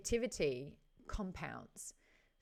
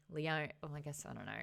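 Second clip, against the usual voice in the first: ordinary talk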